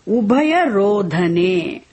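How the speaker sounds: very slow, each word drawn out; slow, clear recitation of ubhaya rodhane. Shown as a demonstration. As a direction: lay out the phrase slowly for learners. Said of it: "he says".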